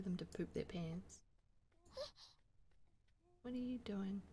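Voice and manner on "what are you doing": hushed puzzled whisper. Real, not acted. Surprised at sim's action.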